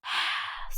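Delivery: a quiet breathy sigh of worry; weary mother's sigh; soft sigh. Acted. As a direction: let out a tired sigh. Mother sighs over her sulking daughter.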